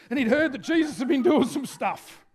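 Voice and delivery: ordinary talk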